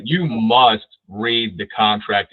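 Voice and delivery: each word of "You must read the contract" laid down slowly and firmly; slow emphasis. Shown as a demonstration. Instructor telling agents slowly and firmly to read contracts. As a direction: drive it home word by word. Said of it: "I can't emphasize this enough".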